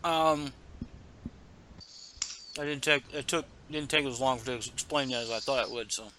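talking through a mouthful of food; words round a bite of food; mouth full. Real, not acted. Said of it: "caught me with food in my mouth".

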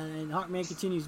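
ordinary talk, nothing marked

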